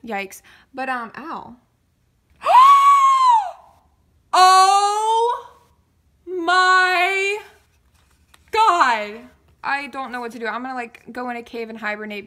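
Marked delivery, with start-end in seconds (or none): 2.4-3.6 loud, piercing gasp-scream; high shocked scream. Real, not acted. Overwhelmed shock at the unboxing. 4.4-9.2 each word loud and drawn out, with long pauses; overwhelmed exclamation. Real, not acted.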